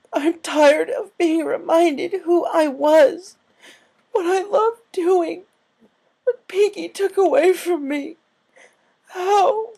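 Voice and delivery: high, loud, very slow crying voice; frustrated sobbing. A narrator's reading. Rainbow crying out her frustration to Applejack. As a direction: cry it out in frustration. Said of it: "frustrated, scared look".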